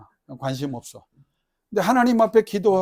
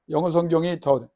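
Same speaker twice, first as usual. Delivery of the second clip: ordinary talk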